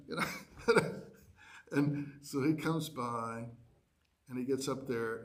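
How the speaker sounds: ordinary talk, nothing marked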